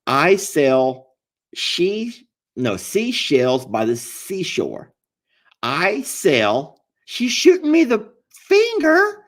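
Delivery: "seashells" said slowly, every s clear; slow tongue twister. Shown as a demonstration. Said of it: "Can you say".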